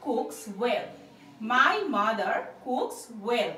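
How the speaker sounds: very slow, every word spaced for learners; slow model sentence. Shown as a demonstration. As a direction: say it slowly for the class to copy. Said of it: "তাহলে কিভাবে বলবো".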